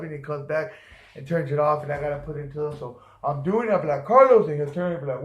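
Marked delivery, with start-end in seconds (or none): 4.0-4.5 loud call, pitched higher. Retold or imitated. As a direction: call his name across the room. Calling his coworker again.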